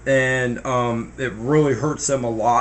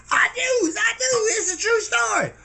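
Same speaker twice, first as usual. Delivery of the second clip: put-on high, insistent old Papa; insisting old man. Papa insisting his story is true, high and stubborn.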